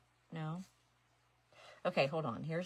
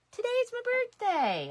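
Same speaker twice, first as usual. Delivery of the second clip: much higher and louder, sing-song glee; gleeful repeat; 12 dB louder than usual. Real, not acted. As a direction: shoot up high with delight. Repeating birthday announcement.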